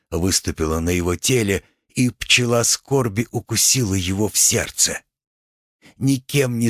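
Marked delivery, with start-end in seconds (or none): none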